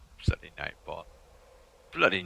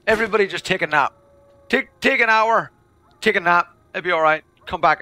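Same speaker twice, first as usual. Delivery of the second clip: loud urging; 9 semitones higher, 10 dB louder than usual. Real, not acted. Urging everyone to nap.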